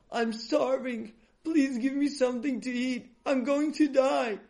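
high-pitched, desperate plea; begging. A narrator's reading. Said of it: "he begged Yankala".

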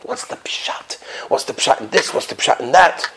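whispered, wondering questions; hushed questioning. Acted. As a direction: drop to a near-whisper, puzzling it out. Retells the Ramban's private questions.

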